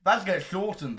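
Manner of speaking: ordinary talk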